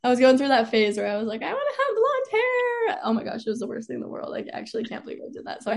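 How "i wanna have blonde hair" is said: self-mocking whine. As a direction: mimic your silly younger self way up high. Mocking her younger self's whim.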